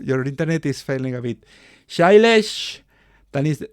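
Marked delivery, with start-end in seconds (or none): 1.9-2.8 loud, high call of a name. Real, not acted. Host going through participants' names, calling out.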